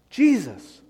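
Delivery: Jesus, called out loud to the congregation. Real, not acted. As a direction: ring out the answer, Jesus. Preacher calls out the answer.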